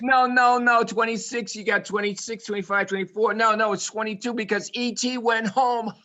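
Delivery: high, rattled-off comic correction; excited joke telling. Pretend. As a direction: push insistently to the punchline.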